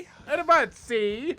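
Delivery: high, loud 1930s gangster, see; a wiseguy tough talking, see; old-timey voice. Pretend. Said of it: "The old-timey voice".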